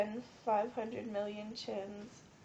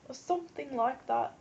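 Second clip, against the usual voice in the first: louder put-on British accent; mock British accent; 2 semitones higher, 6 dB louder than usual. Sick vlogger playing with voices, mock British accent. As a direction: keep up a playful British accent. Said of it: "like, a British accent?".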